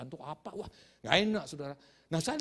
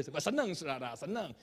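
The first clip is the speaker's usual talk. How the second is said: normal talking voice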